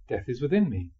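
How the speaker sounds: ordinary talk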